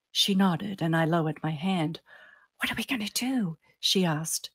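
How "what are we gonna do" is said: high, soft whispered question; whispery and small, higher pitched; hushed worry. A narrator's reading. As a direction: ask it in a raised, anxious whisper. Frightened friend asks in hiding.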